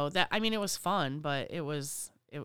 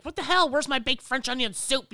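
high, loud mock outrage; mock angry server; 8 semitones higher, 6 dB louder than usual. Server yelling at the kitchen, high mock outrage. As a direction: huff it out like a stressed waitress. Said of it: "you're like".

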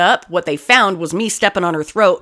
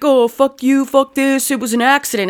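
higher, mocking imitation of a mouthy woman; 6 semitones higher than usual. Imitating the woman caught keying, mouthy mock. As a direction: sneer it like the caught vandal. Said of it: "she got mouthy. She got smart".

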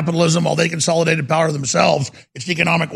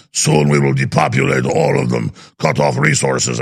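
lower, slow, villainous German-tinged elite voice; sinister elite; 6 semitones lower than usual. Retold or imitated. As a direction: sneer it like a cartoon villain plotting. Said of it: "people that are like".

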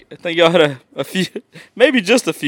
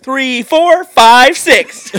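hyped gym count; 10 semitones higher, 6 dB louder, much slower than usual. Retold or imitated. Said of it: "it's like".

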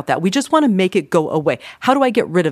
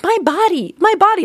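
much higher, sing-song my body; mocking impression; 3 dB louder than usual. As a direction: tease by imitating the podcast host. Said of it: "he was like".

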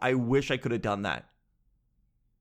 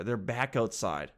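usual speaking voice

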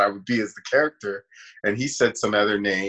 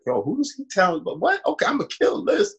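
much higher, rising fired-up challenge; fired-up defiance; 11 semitones higher than usual. Retold or imitated. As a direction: psych yourself up, indignant. Retold defiant inner reaction.

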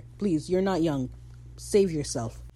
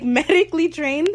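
loud, high rising question; high-pitched, loud incredulity; incredulous; 10 semitones higher, 9 dB louder than usual. Acted. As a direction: repeat it in mock disbelief. Reacting in disbelief to an excuse.

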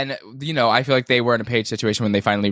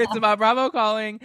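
laughing sing-song; 10 semitones higher than usual. Pretend. Joking about a phone call.

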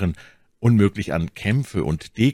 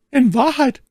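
high, loud lady's exclamation; exclaiming; 7 dB louder than usual. A narrator's reading. My Lady reproaches a hesitant D'Artagnan, exclaiming. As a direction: burst out high and impatient. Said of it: "rief sie".

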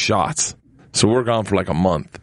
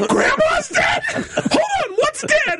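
breaking into laughter mid-quote; laughing through a quote; slower than usual. Real, not acted. Kids' shock retold while laughing.

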